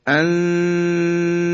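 "انتم" with the noon held long as a high hidden hum; ikhfa. Shown as a demonstration. Teacher shows noon before ta, long nasalised ikhfa.

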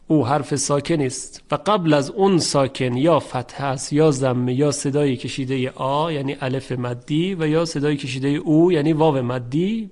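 normal talking voice

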